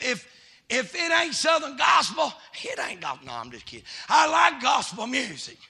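fiery revival preacher calling out; preaching at full force. Acted. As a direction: hammer it home to the congregation.

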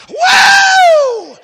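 high, loud laugh into a whoop; ecstatic laughing whoop. Real, not acted. Preacher laughing and whooping in joy. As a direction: burst out in joyful cry.

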